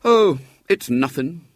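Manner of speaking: put-on high, slow boyish modesty; modest. A narrator's reading. Boy to grateful girl, modest. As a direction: drawl it as if shrugging off praise. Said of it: "he said modestly".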